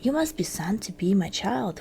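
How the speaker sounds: ordinary delivery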